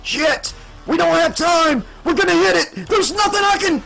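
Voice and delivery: very high yell, climbing to the cut-off; panicked yell. A narrator's reading. Pilot seeing certain collision, high panicked yell. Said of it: "Warlock began to yell".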